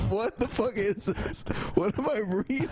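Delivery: real shocked laughter-edged question; incredulous. Real, not acted. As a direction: react to absurd words. Disbelief at the ad.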